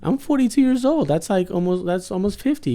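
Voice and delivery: retelling a stranger's remark, mock offended shriek; mock shocked disbelief. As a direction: act out your own indignant surprise, high and pushed. Retelling his shocked reply on bus.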